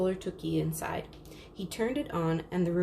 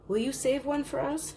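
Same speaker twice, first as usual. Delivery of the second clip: put-on higher pleading child voice; begging; 7 semitones higher than usual. Little girl begs the lamp seller, higher pleading voice. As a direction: ask the lamp seller as if everything depends on it. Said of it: "she begged".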